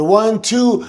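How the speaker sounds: high, rising count; referee count. Retold or imitated. Wrestler retelling the near fall, a rising high referee count. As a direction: count it out over the roaring crowd.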